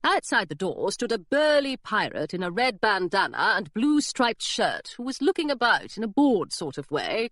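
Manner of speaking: usual speaking voice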